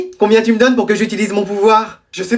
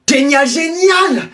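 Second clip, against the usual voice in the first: exaggerated cheer; 5 semitones higher, much slower than usual. Acted cheer, slow and stretched.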